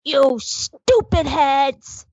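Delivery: put-on slow, drawn-out childish taunt; a hint of a little boy shouting; mild mock kid yell. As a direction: son pointing a stick sword at adults, mock yell. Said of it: "and just yelled".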